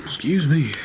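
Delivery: burping. Real, not acted. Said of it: "so I'm burping up some water here".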